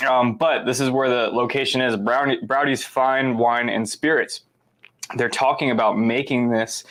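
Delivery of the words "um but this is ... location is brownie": usual speaking voice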